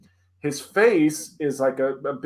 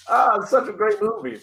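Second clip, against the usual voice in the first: higher, gushing imitation of a delighted dad; amused dad; 8 semitones higher, 4 dB louder than usual. Friend's dad enjoying Monty Python, imitated high gushing. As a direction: gush like a dad tickled by his film, pitched up. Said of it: "he's laughing".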